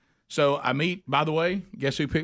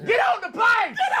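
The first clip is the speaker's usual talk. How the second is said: very high, loud exclamation; mock triumphant shout; 5 dB louder than usual. Gate agent sends passenger aboard, hammed-up high shout.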